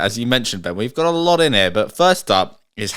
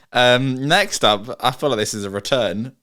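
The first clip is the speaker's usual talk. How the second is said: usual speaking voice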